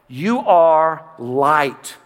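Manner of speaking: very slow, each word weighed; slow emphasis. Acted. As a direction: let every word land, slowly. Slow emphatic scripture line.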